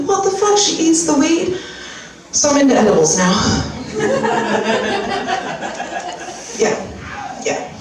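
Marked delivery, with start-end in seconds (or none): 0.1-1.6 much higher, stunned; shocked disbelief. Retold or imitated. Owner stunned the puppy obeys for weed, much higher and incredulous. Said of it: "And I'm like". 3.4-6.5 long high airy laugh; breathy laugh. Real, not acted. Comedian laughing at own edibles joke, high airy laugh.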